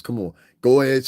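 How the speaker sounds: normal talking voice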